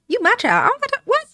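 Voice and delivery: high-pitched, incredulous mom; mock indignation. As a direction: play an affronted mother, pitch shooting up. Mother indignant at daughter's complaint.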